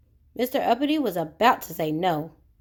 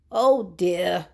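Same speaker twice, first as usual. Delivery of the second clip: gasp; 3 semitones lower than usual. Acted. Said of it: "he gasped".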